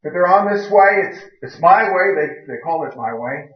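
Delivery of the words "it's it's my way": ordinary delivery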